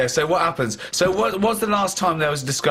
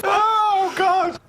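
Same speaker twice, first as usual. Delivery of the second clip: higher, amused exasperation; amused disbelief; much slower than usual. Real, not acted. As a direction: groan it out in comic disbelief. Reacting in disbelief.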